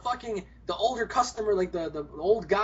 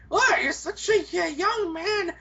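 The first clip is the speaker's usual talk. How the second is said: put-on high, slow, gushing old lady; gushing granny; 7 semitones higher, slower than usual. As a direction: coo over him like a smitten granny. Old lady flattering teller.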